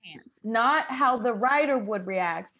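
usual speaking voice

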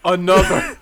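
amused echo, laughing; laughing as you echo it. Real, not acted. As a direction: repeat the word through a laugh. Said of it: "echoing with a laugh".

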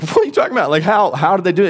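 high, loud, incredulous burst; stunned disbelief. Retold or imitated. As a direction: react like you can't believe the news. Stunned reply to unbelievable news.

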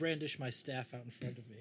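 ordinary talk, nothing marked

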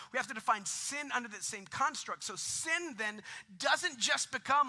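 full-voiced pulpit build; rising preaching. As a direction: preach it with growing force.

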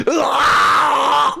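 high mock scream of pain and frustration; imitated pained scream. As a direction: mimic a drawn-out, high-pitched howl of pain. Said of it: "this one is just pain and frustration".

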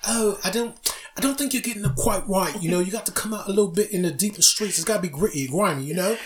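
put-on British accent. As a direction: talk down to him in a British accent. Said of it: "the British accent".

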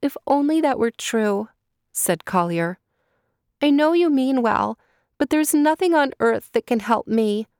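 put-on a girl refusing false hope; hopeless girl.

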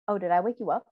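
ordinary talk, nothing marked

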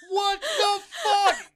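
very high, slow, drawn-out disbelief; wailed, hammed-up exasperation; retold dismay. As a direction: stretch it out in high-pitched bewilderment. Bewildered after door slammed on him.

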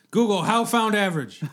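laughing broken caveman grunt; caveman talk. Retold or imitated. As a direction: blurt it like a clueless caveman. Mocking broken search terms.

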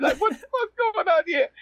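very high, laughing voice. Retold or imitated. Young actor baffled by the stranger's compliment, laughing high. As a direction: squeeze the question out through laughter. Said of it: "I'm like, I'm laughing".

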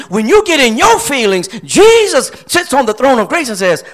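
ordinary delivery

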